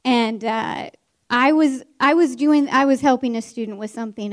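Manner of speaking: ordinary delivery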